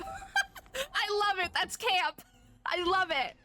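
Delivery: high-pitched, thrilled gushing; delighted squeal. Real, not acted. Delighted reaction to trailer.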